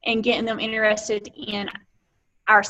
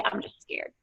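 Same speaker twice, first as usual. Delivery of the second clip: whispered admission; 12 dB quieter than usual. Shown as a demonstration. Honest admission of fear.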